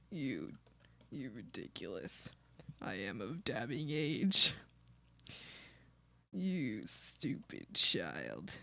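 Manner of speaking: mock grumpy elder. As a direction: scold like an ancient grump. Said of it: "And I'm like".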